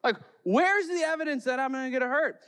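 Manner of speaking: put-on a boy arguing back at Mom; mad kid. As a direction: demand proof like an angry child. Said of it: "I remember being so mad".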